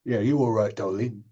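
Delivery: put-on seedy Cockney gangster, Cockney accent; oily Cockney charmer, Cockney accent. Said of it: "all my slightly seedy gangsters are always Cockneys".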